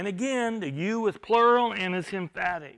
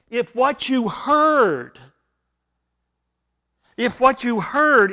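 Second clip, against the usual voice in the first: preaching emphasis; 3 semitones higher, 10 dB louder, slower than usual. Real, not acted. Preacher repeating the key phrase.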